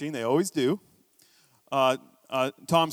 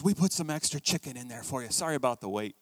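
cashier; 4 semitones lower, 6 dB quieter than usual. Retold or imitated. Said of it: "finally she goes".

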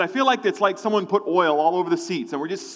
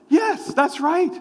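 high, drawn-out triumphant yes; elated realization; 10 semitones higher, much slower than usual. Retold or imitated. Man answering God in his prayers, elated. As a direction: exclaim it high, stretching the words in relief. Acted moment of revelation in prayer.

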